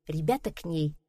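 plain reading voice

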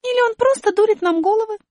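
высоко и громко, недоумевая; маленькая героиня возмущённо спрашивает; высокий удивлённый голос. Acted. Аля подозревает. (высокий удивлённый голос героини)

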